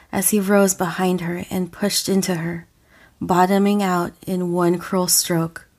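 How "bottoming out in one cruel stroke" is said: ordinary delivery, nothing marked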